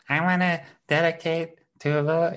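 high put-on caller voice; imitated radio caller. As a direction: mimic a listener phoning in a dedication. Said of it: "you're like".

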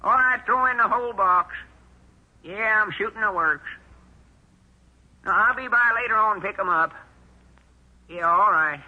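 pitched well up to fool the caller; old man faking a high voice on the phone; high disguise voice. Acted. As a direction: put on a high voice to disguise yourself. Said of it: "I'll use my high voice".

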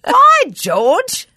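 high, loud hammed-up gentleman; mock posh "so it is"; posh gent. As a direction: play a gracious old-fashioned gent. Continues posh gentleman bit.

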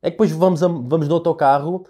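ordinary delivery

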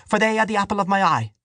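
high, indignant quoting of scripture; angry interruption. A narrator's reading. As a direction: press it home angrily in a high voice. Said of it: "Dante broke in angrily".

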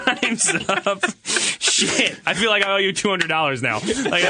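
high and breathless with laughter; choking out words mid-laugh. Real, not acted. Still cracking up.

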